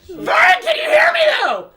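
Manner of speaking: high, loud, fast whining shout; desperate call. Real, not acted. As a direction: plead for attention at full volume. Desperate calling out.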